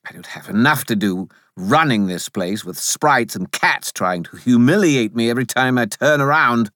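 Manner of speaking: lightly voiced, put-upon spirit; hint of a harried complainer; exasperated Bob. A narrator's reading. Bob complains after the cat pounces.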